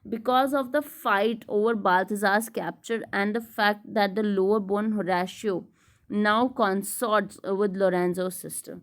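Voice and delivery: slow, measured read-aloud of study notes; deliberate lecture-room reading. Acted. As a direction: read the text out slowly and deliberately. Reads English summary aloud.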